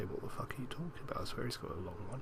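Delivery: quick, quiet mock outburst; retold outburst. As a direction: rattle it off under your breath. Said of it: "I was about to say".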